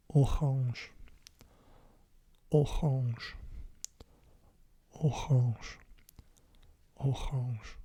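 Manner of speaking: orange said the French way, with a throaty r and nasal end; French pronunciation. Shown as a demonstration. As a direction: orange as a French person would say it. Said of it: "like a French person would".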